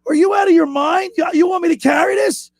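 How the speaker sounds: hammed-up disbelief at the request; mock outrage. Caddy protesting to the celebrity golfer, mock outraged.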